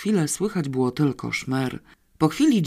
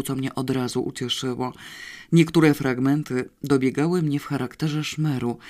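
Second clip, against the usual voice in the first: usual speaking voice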